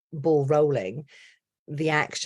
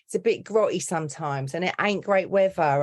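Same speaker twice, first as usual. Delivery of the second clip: Cockney with attitude, slightly raised pitch; Cockney accent; 3 semitones higher than usual. Shown as a demonstration. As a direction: East End London Cockney demonstration. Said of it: "With a Cockney accent, you know, like, it's real attitudey".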